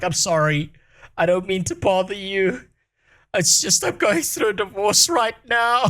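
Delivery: a hint of a tearful sad man apologizing; quoting crying man. Stranger apologizes to the streamer, mildly tearful. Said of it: "He was like".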